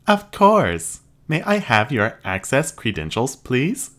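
high, even robot voice; polite machine receptionist. Acted. Robot replies.

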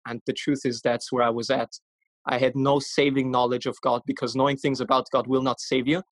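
ordinary delivery

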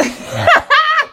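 short high laugh; real amused laugh; high burst of laughter. Real, not acted. Laughs at creep story.

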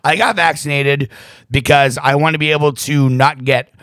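ordinary delivery

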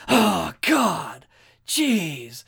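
hammed-up whiny outburst like a ten-year-old; tantrum. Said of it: "Throws, like, this huge, like, tantrum".

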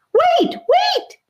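put-on very high chirpy boy call; excited little boy calling after them; chirping. A narrator's reading. Boy running after friends, very high chirping call. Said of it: "Charlie chirping behind them".

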